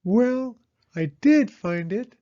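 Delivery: a proud owl drawing it out; Owl's voice. A narrator's reading. Owl claiming credit for finding it, high, loud and slow.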